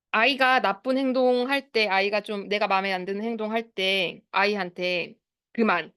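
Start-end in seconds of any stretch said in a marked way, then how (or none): 5.5-5.9 mock-stern order to stop; firm stop command. Shown as a demonstration. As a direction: demonstrate an adult's curt telling-off tone. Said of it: "이 톤 아시죠?". (어른이 단호하게 제지하는 톤)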